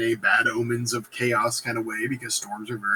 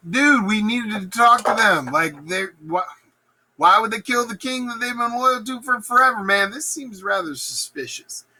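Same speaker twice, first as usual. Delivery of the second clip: suspicious dude; 8 semitones higher than usual. Acted. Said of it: "Macduff's like".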